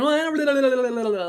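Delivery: parodying someone who can't just say no; hesitant mock excuse. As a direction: stretch out a flustered excuse. Mocking someone over-explaining a refusal.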